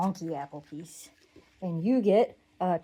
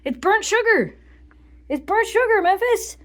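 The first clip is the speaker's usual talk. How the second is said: loud, high excited exclamation; excited shout; 12 semitones higher, 10 dB louder than usual. Real, not acted. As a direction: shout it out delighted. Excited shout about answer.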